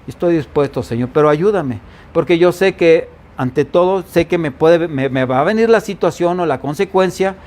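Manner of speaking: normal talking voice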